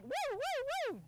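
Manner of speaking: high squealing woo-hoos; shrieking jackpot winner. Retold or imitated. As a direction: prance it out like a pom-pom Chihuahua. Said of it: "It's like".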